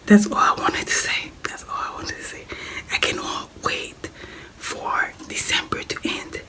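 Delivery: whispering to rest a sore throat. Real, not acted. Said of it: "I shouldn't talk".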